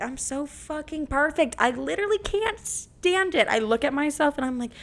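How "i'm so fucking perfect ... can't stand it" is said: high, slow and swooning; hammed-up mirror adoration, rising higher; gushing self-love. Shown as a demonstration. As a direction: gush over your own reflection. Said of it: "look at yourself in the mirror and be like".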